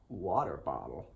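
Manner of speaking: low, tentative American accent; American accent attempt. Shown as a demonstration. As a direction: try "water bottle" the American way. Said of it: "your American accent".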